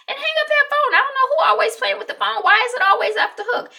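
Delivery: loud, fast, high-pitched mom rant; exasperated scolding. Retold or imitated. Beth scolds whoever played with the phone, exasperated. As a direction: imitate a mother fed up with the household.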